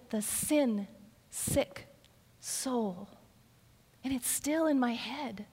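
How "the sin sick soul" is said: "the sin-sick soul" slow, every word spelled out; over enunciating. Retold or imitated. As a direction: stretch "sin-sick" syllable by syllable, dropping soft. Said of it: "She'd say".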